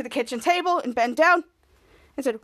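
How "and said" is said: usual speaking voice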